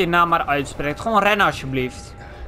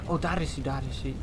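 hushed; 6 semitones lower, 11 dB quieter than usual. Real, not acted. Spotting the enemy while sneaking.

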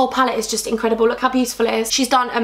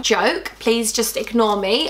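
usual speaking voice

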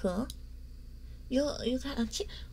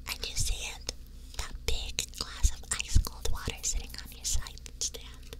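ASMR whisper; 2 semitones lower, 4 dB quieter than usual. Acted.

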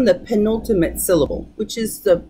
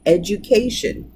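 education with the stress on CA, the second-to-last syllable; stress on the penultimate. Shown as a demonstration. Teacher repeating education stressed on CA. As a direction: hit CA in education. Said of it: "the penultimate".

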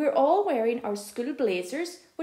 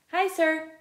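bright, higher cheery greeting; beaming; 6 semitones higher, 4 dB louder than usual. A narrator's reading. Jake cheerfully greeting the teacher, beaming. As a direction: greet the teacher with a big grin. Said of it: "Jake beams".

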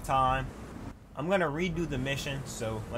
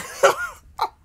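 high, loud burst of stunned laughter; shocked laugh; 11 dB louder than usual. Real, not acted. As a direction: laugh out in disbelief.

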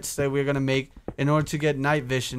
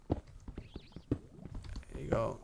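whispering; 4 semitones lower, 15 dB quieter, much slower than usual. Real, not acted. Whispered under breath while placing.

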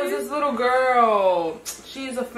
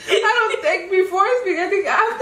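high voice broken by laughter; giggling through the words; laughing; 9 semitones higher than usual. Real, not acted. Laughing at himself.

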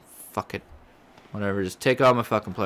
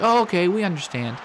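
mock board reply; 10 semitones higher, 6 dB louder than usual. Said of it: "They're like".

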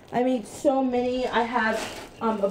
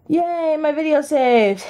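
slow, loud happy cheer; excited; 6 semitones higher, 5 dB louder, slower than usual. Real, not acted. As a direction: cheer at the good news. Video finished saving.

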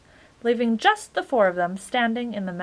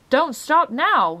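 higher, louder, drawn-out urging; insistent; 7 semitones higher, 8 dB louder, slower than usual. A narrator's reading. Magician urging his guests to keep eating, insistent. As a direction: press them firmly to keep going. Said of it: "he insisted".

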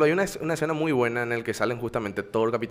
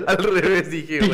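laughing; 5 semitones higher, 5 dB louder than usual. Real, not acted. Laughing at own name mix-up.

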